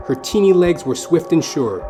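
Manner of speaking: usual speaking voice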